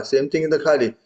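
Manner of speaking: ordinary delivery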